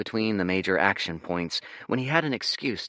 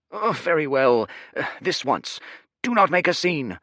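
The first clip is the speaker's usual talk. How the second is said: higher, louder, hurried waiter; flustered server; 8 semitones higher, 4 dB louder than usual. Acted. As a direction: give in, flustered. Harried server gives in.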